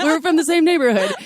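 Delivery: put-on loud, high British gangster accent; Tom Hardy impression. Correcting the co-host's version, loud hammed-up British accent. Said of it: "He sounded exactly like Tom Hardy".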